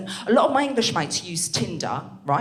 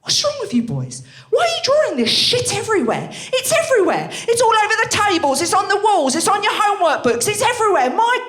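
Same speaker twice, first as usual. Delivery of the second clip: high, loud outraged rant; ranting teacher; 11 semitones higher, 5 dB louder than usual. Retold or imitated. Feminist teacher scolding the boys, outraged shouting. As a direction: let the fury climb line by line. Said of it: "she goes".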